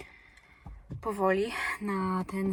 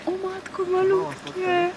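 cooing; 11 semitones higher, 3 dB louder, slower than usual. Real, not acted. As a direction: coo over something tiny. Cooing at tiny animals. (rozczulone gruchanie)

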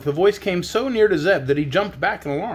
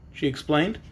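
ordinary delivery

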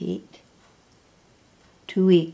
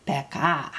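"peka" with weight on the final "ka"; short "pe", stronger "ka"; stress on KA; 3 semitones lower, 3 dB quieter than usual. Shown as a demonstration. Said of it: "you're gonna say kan louder".

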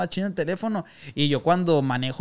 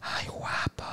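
breathy whisper into the ear; flirty whisper; 9 dB quieter, much slower than usual. Retold or imitated. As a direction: whisper it close to his neck. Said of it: "pero me hace así".

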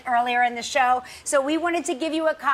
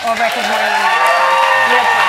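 announcer flourish; 3 semitones lower, 9 dB louder, much faster than usual. Real, not acted. Announcing the new show.

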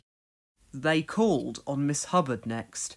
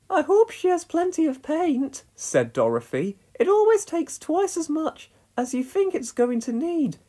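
high, chatty farm-wife voice; a homely northern woman sharing wisdom; Dorothy voice; 13 semitones higher, 4 dB louder than usual. A narrator's reading. Dorothy chats with Pat about painting.

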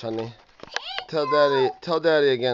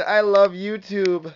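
slow demo; 3 semitones higher, 4 dB louder, slower than usual. Shown as a demonstration.

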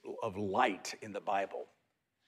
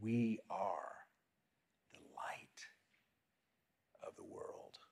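whisper; 10 dB quieter than usual. Shown as a demonstration. Pastor whispers the affirmation for listeners to echo. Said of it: "Whisper that".

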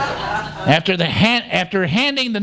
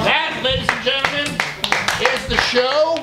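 showman announcement; 5 semitones higher than usual. Acted. Host announcing the end.

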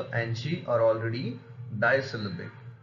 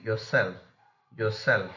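light "your", strong "self" in "yourself"; stressed suffix. Shown as a demonstration. Teacher shows suffix rule, yourself stressed on SELF. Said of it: "suffixes will only receive the stress".

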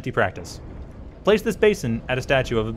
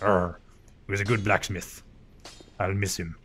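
put-on softer, lower Cornwall accent, less piratey; toned-down pirate accent; 5 semitones lower, 5 dB quieter than usual. Gamer voicing Andre missing the giant, in a milder Cornwall accent. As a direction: ease off the pirate, keep it Cornish. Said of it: "Except not quite as piratey".

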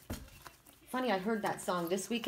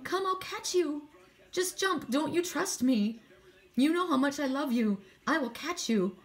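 coaxing parent; 5 semitones higher than usual. Acted. Parent coaxing child to jump.

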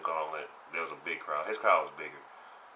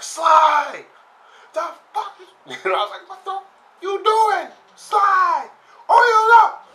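high, loud yelling with a laugh; furious yelling; 16 dB louder than usual. Retold or imitated. Angry player yelling at the stream, high and loud. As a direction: scream advice at the screen.

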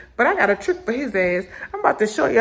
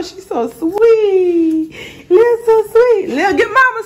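cooing; 8 semitones higher, 3 dB louder, slower than usual. Real, not acted. Mom gushing over sweet little one sharing, cooing. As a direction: coo higher and higher, stretching the praise.

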